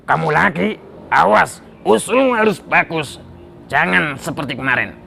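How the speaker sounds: lightly voiced king giving a warning; wary king. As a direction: warn the minister with royal suspicion. King warns the minister.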